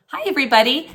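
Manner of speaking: bright, loud hello to the class; excited greeting. Real, not acted. Teacher greeting her students, cheerful and loud.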